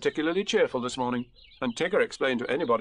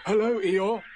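higher friendly greeting in character; Pooh; 9 semitones higher, 4 dB louder, slower than usual. A narrator's reading. Bear greeting his gloomy friend, in a higher voice. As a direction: call out a greeting, pitched up. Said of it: "said Pooh".

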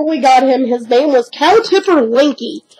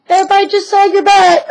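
high dog voice; 8 semitones higher, faster than usual. Pretend. Repeats line in dog voice.

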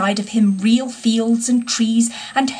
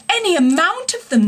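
higher, mild mock sob; a touch of hammed-up tears; sobbing; 7 semitones higher than usual. A narrator's reading. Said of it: "sobbed Toad".

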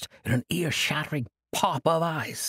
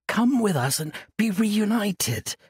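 pleading; 8 semitones higher, slower than usual. Acted. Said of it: "Orin pleaded".

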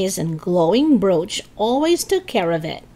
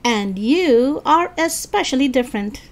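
higher, mocking jab; snickering tease; 6 semitones higher than usual. A narrator's reading. Eddie mocks Lisa's little speech, snickering. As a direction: tease with a mild sneer, no laugh. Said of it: "Eddie snickered".

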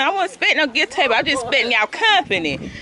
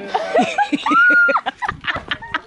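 squealing burst of laughter; high-pitched laugh. Real, not acted. Vlogger tickled by her own feet, squealing laugh.

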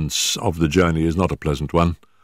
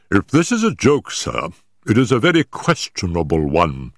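mild anger; 3 semitones higher than usual. Acted. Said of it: "said the vicar angrily".

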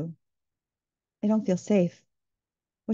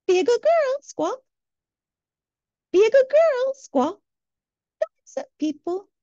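much higher, shrill-pitched nagging bird voice ending each line in a squawk; squawking mockingbird; 11 semitones higher, 3 dB louder than usual. The inner mockingbird scolds her to be a good girl, squawking high. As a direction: play the mockingbird scolding inside her head. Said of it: "the mockingbird in my head continued to squawk".

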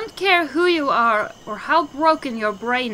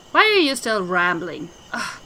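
ordinary talk

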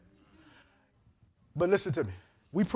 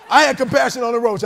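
ordinary talk

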